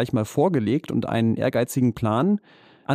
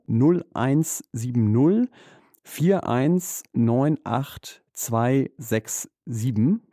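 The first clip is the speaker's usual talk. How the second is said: slow number read; much slower than usual. Shown as a demonstration. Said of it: "jetzt noch mal ganz langsam".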